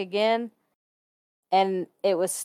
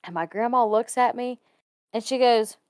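usual speaking voice

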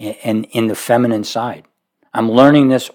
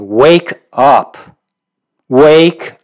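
louder, rising wake-up call to a sleeping church; 3 semitones higher, 5 dB louder, much slower than usual. Retold or imitated. Pastor rousing his drowsy congregation, louder wake-up call. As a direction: shake them awake with a rising call. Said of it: "but I really feel it sometimes".

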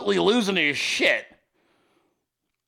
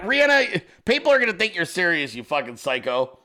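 normal talking voice

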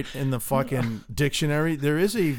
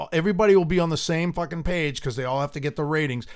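ordinary talk

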